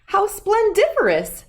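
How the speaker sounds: a friend squealing with delight. A narrator's reading. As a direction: squeal it with glee. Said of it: "squealed Ellie".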